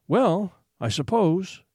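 slow, weary drawl; slowly and bitterly. Acted. Mother answering her son about money, slow and bitter. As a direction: stretch it out, tired of the question. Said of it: "she said slowly and bitterly".

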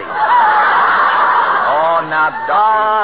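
high squealing laugh into a mild protest. Acted. Tailor laughing as he calms the doctor, laughing. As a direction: laugh it off, chiding him.

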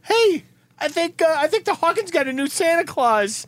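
a gossiping New York neighbor; New York accent. Retold or imitated. Neighbor driving by gossips in a New York accent. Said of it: "neighbors that sound like that. Like from New York".

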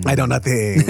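heavy mock Japanese accent; japanese learner accent. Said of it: "try to speak Japanese".

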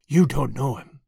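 hoarse repeat. A narrator's reading. Frightened woman insists to Tuppence, hoarse and low. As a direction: rasp it out again, insisting. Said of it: "she reiterated hoarsely".

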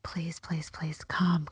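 whispered please through the window; desperate whisper. Retold or imitated. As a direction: beg under your breath. Begging under her breath.

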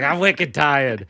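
put-on slow Boston toddler; Boston accent. Comic imitates Boston toddlers complaining, slow Boston accent. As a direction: drawl "wicked tired" in broad Bostonian. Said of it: "Boston toddlers are like".

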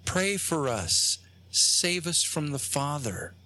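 slow, haunting imitation of children's voices; ghostly children. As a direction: imitate eerie children begging, drawn out. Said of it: "children. And they're saying things like".

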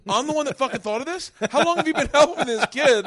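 loud, high, incredulous berating; retold indignant outburst. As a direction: act out an exasperated parent. Parent berates aides in retelling.

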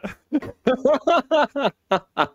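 high villainous cackle; unhinged villain cackle. Acted. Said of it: "What a bad man".

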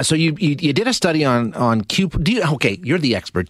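ordinary talk, nothing marked

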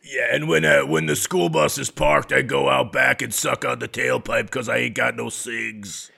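loud raspy smoker riff. Pretend. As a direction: push it out like a hardened smoker. Continuing smoker receptionist bit.

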